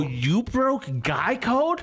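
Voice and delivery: high, drawn-out incredulous accusation; theatrical wounded protest; mock betrayed outrage. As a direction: stretch it out in high disbelief. Retold accusation of father, drawn out.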